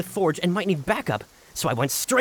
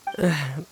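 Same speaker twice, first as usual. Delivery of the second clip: weary sigh; 3 semitones lower than usual. Acted. Colleague sighing before admitting he found nothing, weary sigh. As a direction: let out a sigh before confessing.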